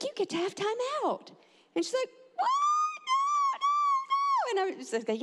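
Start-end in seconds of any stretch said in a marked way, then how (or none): 0.0-1.3 high, singsong mom voice; mock-cheery announcement. Mother telling daughter of timeout, high and singsong. 2.3-4.6 very high imitated child wail; mock little-girl cry of protest. Girl learning of her timeout, high imitated wail.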